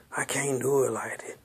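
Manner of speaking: unvoiced whisper of a timid excuse. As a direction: breathe it out with no voice, like a scared excuse.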